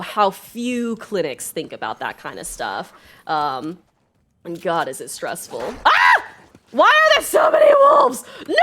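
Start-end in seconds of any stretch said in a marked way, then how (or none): 5.9-8.5 shrieking high and loud at the wolves; terrified gamer yelling at attacking wolves; panicked screams. Real, not acted.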